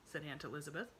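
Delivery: plain reading voice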